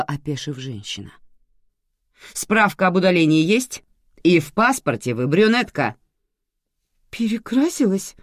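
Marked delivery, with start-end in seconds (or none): 2.3-6.0 loud, higher questioning; stern official cross-examination; interrogating. A narrator's reading. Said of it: "спрашивала так, будто вела допрос". 7.1-8.1 high, tearful answer; distressed woman, pitch climbing; near tears. A narrator's reading. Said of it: "чуть не заплакала женщина".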